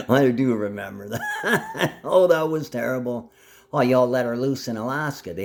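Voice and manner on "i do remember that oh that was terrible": laughing through the words; amused, drawn-out admission between laughs; laughing recollection. Real, not acted. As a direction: break up laughing as you admit it. Laughing at own memory.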